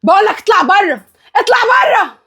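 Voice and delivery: high-pitched furious order; yelling. Acted. Woman ordering her fiancé out, yelling. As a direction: climb to a high yell. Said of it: "وايه الزعيق والصوت".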